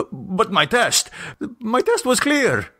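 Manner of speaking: high, rising cry of alarm; panicked protest. Acted. Jose panics in the car.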